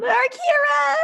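high shout across the distance; calling out. Acted. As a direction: yell her name, high and loud. Calling out while spinning.

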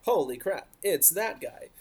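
lightly imitated fan excitement, pitch rising on "that guy"; mock excited fans. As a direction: play a diehard fan spotting a favorite. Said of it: "so excited 'cause they're like".

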